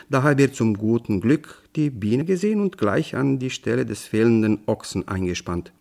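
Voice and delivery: plain reading voice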